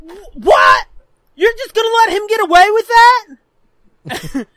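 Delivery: high, loud incredulous whine; imitating a wronged kid appealing to mom; outraged brother. Brother protesting to mom, loud whiny outrage. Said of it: "And he literally says".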